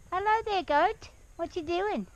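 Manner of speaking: high, friendly greeting to an animal; sing-song hello to a goat; chirpy pet voice. Real, not acted.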